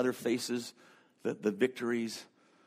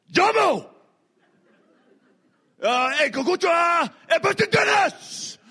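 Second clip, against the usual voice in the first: imitating an interpreter's booming, much higher, much louder greeting; over-the-top excited shout; 8 dB louder than usual. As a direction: belt it out like a hyped-up crowd warmer, with a laugh. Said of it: "looks at me and goes".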